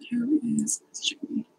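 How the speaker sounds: soft puzzled whisper to herself. Real, not acted. Streamer reading an unknown name in chat, puzzled whisper. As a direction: whisper it, wondering who this is.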